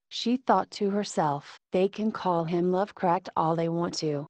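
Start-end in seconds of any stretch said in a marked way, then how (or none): none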